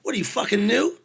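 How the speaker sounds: loud, high scolding yell; roadie yelling. Retold or imitated. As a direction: bark at the new guy for touching the cheese. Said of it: "the guy sitting at the table goes".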